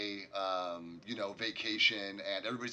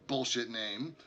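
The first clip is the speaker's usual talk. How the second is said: ordinary talk, nothing marked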